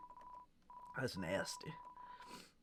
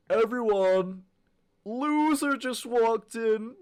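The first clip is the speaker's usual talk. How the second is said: high, loud schoolyard taunt; taunting bully voice; 13 semitones higher, 12 dB louder, much slower than usual. Acted. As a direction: shout it out to mock the new kid. Voicing a taunting classmate.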